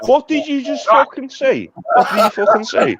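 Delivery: high-pitched, indignant demand; indignant challenge. Real, not acted. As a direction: demand it as if insulted. Challenges a jibe at his side.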